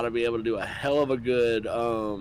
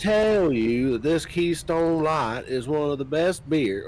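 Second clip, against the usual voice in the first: slow, higher Texas accent impression; Texas drawl; 4 semitones higher, 3 dB louder, slower than usual. Shown as a demonstration. Trying out old Southern accent on beer, slow Texas drawl. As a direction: stretch it out in a mock Texas drawl. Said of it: "Well, this is more Texas".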